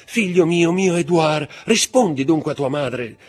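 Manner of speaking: mother crying out to her unconscious son, frantic; desperate calling. Acted. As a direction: call to your fainted son as panic takes over.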